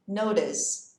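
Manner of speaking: "Notice" with a light D in the middle; flapped T. Shown as a demonstration. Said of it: "aqui eu coloco esse som de D".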